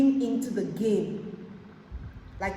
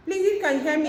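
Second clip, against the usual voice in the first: raised, higher voice over a bad line; calling out; 7 semitones higher, 7 dB louder, much faster than usual. Real, not acted. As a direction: call loud and high so they hear. Calling out to a caller who can't hear her.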